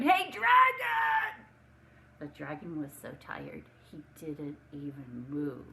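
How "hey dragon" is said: very loud, high, slow yell; loudest shout. Acted. Princess yelling into the sleeping dragon's ear, drawn-out shout. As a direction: bellow it into his ear. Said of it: "shouted as loud as she could".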